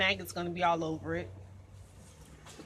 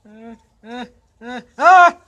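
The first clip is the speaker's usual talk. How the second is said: whimpers into scream; 20 dB louder than usual. Real, not acted. Woman nudging the bird, whimpering then screaming.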